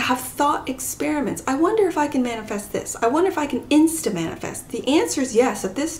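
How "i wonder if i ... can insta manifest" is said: fast, curious thinking aloud; quick musing. Acted. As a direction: rattle off the wondering. Voicing someone's inner thought.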